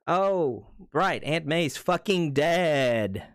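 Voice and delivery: high, drawn-out exasperated outburst; sarcastic mock realization. Reminding the cheering crowd that Aunt May died, sarcastic exasperation. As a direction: stretch each word in loud, mocking disbelief.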